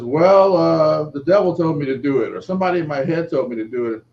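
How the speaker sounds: a high, loud, quick voice of an odd drifter; father retelling what the man who smashed his car said; homeless man's voice. Retold or imitated. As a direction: play the rambling stranger blaming the devil. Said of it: "And he says".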